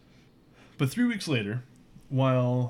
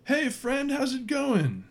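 high, chummy huckster greeting; friendly salesman voice; 14 semitones higher, much slower than usual. Acted. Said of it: "He says".

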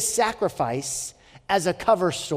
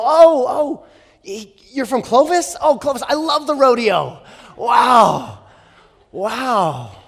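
high, loud mock amazement; butter them up with over-the-top delight; gushing schmoozer; 6 semitones higher, 6 dB louder, slower than usual. Prince flattering a visitor from Clovis, gushing schmooze. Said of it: "then he would schmooze them".

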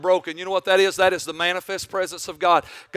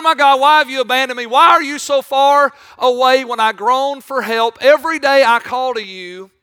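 loud, raised preaching voice; impassioned preaching; 4 semitones higher, 6 dB louder than usual. Acted. Preacher telling congregation of David's trials, impassioned preaching. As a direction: preach it at full force, high and loud. Preacher builds to full force.

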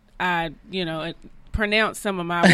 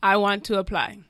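put-on Jamaican accent. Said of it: "you have a Jamaican accent".

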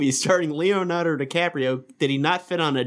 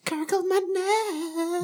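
silly high voice; 3 dB quieter, faster than usual. Retold or imitated. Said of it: "Hey, bitches".

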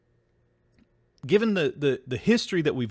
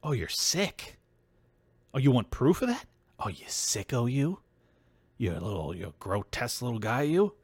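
soft, sneering mock of a smug accuser; 7 dB quieter than usual. Mimicking critics who shame him, a soft sneer. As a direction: hush it down and sneer, mocking them. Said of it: "And then they go".

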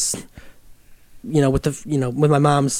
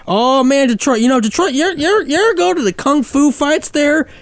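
Southern hillbilly; 10 semitones higher than usual. Retold or imitated. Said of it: "the voice you're doing".

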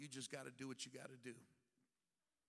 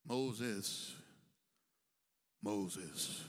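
deep calling voice; 8 semitones lower, 5 dB louder than usual. Acted. God calling Moses' name.

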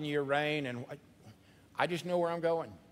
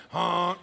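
laughing goose honk; 7 dB louder than usual. Shown as a demonstration. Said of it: "clean your sinuses out".

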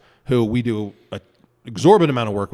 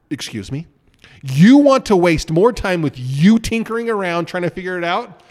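frustrated and pissy; 6 semitones higher, 7 dB louder than usual. Retold or imitated. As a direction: act out being fed up with him, drawn out and rising. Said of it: "when I get all frustrated and pissy".

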